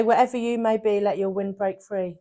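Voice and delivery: low, hushed recital of a proverb; grandma's saying. Retold or imitated. Friend quoting grandma's motto, low and quiet. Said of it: "My grandma used to say".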